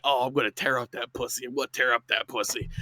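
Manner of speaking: low, soft imitation of phone-sex boasting; mock dirty talk. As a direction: lightly imitate a hushed come-on.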